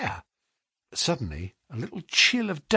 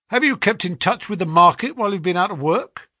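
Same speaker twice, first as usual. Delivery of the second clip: higher, louder, quick quizzing; brisk questioning agent; 7 semitones higher, 4 dB louder than usual. A narrator's reading. Agent checks the clerk's past, brisk and prying. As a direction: fire the question like a busy agent.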